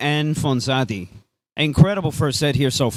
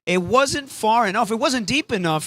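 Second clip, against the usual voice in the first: ordinary talk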